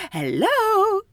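high, mocking hello; incredulous hello. Attendant mocks a passenger's cluelessness, high incredulous. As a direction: pitch it up in disbelief. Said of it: "It's like".

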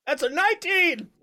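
high, loud burst of delight; excited. Real, not acted. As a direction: cheer the roll out loud. GM reacts to a big roll.

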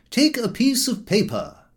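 high-pitched uncle dictating a packing rule; Uncle Podger. Acted. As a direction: play the methodical uncle laying down the rules. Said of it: "he always began at the beginning".

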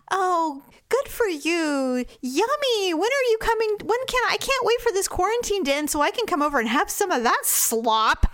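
high, sugary mock enthusiasm; mimicking a too-nice commenter; fake sweet praise. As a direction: gush with exaggerated fake delight. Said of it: "strange will be like".

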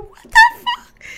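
very high, loud startled cry; shocked disbelief. Retold or imitated. She reacts to the gunfire with a high shocked cry. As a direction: blurt it in total shock. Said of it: "Y yo".

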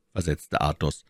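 ordinary delivery

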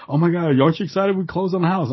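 high, gushing excited wife. Retold or imitated. Husband imitates wife thrilled about closing on house, high and gushing. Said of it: "She goes".

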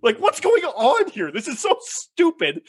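mocking the show to his friends, slow and exasperated; exasperated disbelief. Real, not acted. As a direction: drag it out in disgust. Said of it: "ranting at the episode".

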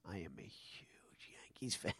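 hushed, whispered confession; faking Yankees fandom. Retold or imitated. Met fan bluffing at an audition, intense whisper. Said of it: "I had to fake being a fan".

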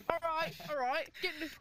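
badly faked British voice, verging on French; bad British accent. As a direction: put on a dubious British accent. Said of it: "That was not a British accent".

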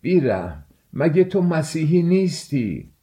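mildly shouted reproach, pitch leaping on the question; reproachful shout. A narrator's reading. Old man in the crowd rebuking Mikolka, shouting. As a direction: cry out in rebuke, rising high. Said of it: "فریاد زد".

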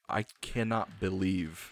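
measured, stunned-style retelling; retold disbelief. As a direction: spell out your disbelief slowly and quietly. Retelling his disbelief at the article.

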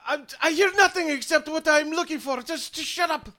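high, frantic, frazzled voice; a harried little man snapping at an intruder; panicked Flizzard. Acted. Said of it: "he looks panicked and worried".

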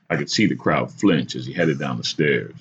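usual speaking voice

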